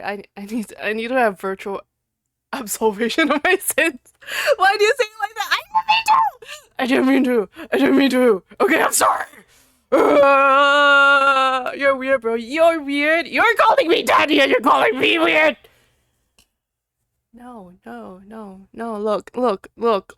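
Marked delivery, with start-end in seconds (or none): 4.6-6.2 high, loud protest; flustered shout. Real, not acted. Streamer yells at chat that she didn't mean it. Defending herself against chat. 6.8-9.3 loud protest. Real, not acted. Repeated defensive protest. 9.9-15.6 loud, high indignant retort; indignant shout. Real, not acted. Streamer shouts back at chat calling her weird.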